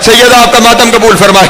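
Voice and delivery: lamenting preacher at full force; impassioned majlis preaching. Acted. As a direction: climb into full grieving oratory. Majlis narration climbs to wailing peak.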